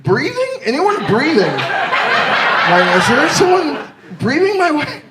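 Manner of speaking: high, hammed-up drunk search for anyone; high giddy drunk voice; drunk desperation. Drunk self hunting at bar.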